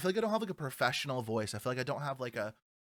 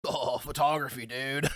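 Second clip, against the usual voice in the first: lightly parodied stoner dude; self-imitation; 2 semitones lower than usual. Imitating himself for his friend, lightly hammed dude voice. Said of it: "I'm done doing imitations of myself".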